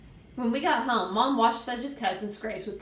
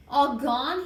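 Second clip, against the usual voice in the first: put-on high, wondering toddler voice; small, rising child voice; little kid's question; 5 semitones higher than usual. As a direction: ask it like a puzzled small child. Small child asks about teeth.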